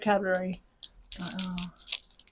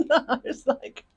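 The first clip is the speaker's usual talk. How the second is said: laughing; 4 semitones higher, 4 dB louder, slower than usual. Real, not acted. Laughing at own joke.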